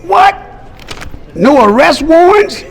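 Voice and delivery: very high, loud mock disbelief; acted astonishment. Security checker finds no arrest warrants, acted astonishment. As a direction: squeal it out in halting, incredulous bursts.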